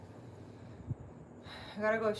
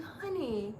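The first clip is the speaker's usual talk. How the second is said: put-on higher, softer, slow tender old lady voice; sweet old psychic; 5 semitones higher, 3 dB quieter than usual. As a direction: coo it like a kindly grandma giving advice. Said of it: "She's like".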